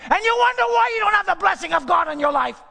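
high, loud pulpit climax; fiery sermon climax, shouting; preached shout. Acted. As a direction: shout the point to the rafters.

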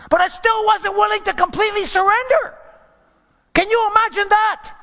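high, loud preacher's cry; full-force preaching exclamation; preaching shout. Real, not acted. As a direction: cry it out from the pulpit. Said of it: "preacher's emphatic exclamation".

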